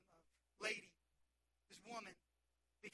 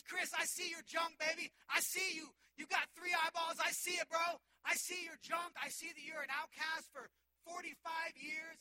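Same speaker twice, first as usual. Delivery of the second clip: Jesus shouting over the crowd, louder and higher; 5 semitones higher, 7 dB louder than usual. Acted. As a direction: call out to him with loud, urgent love. Jesus calls out to Chris.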